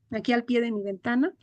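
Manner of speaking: ordinary talk, nothing marked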